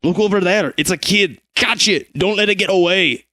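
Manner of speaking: high, urgent guard yells; shouting guards. Acted. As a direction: bark orders to catch the kid.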